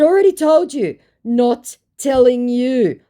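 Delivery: flatly refuse, spacing the words; firm refusal. Retold or imitated. Shutting down the scammer, firm and slow.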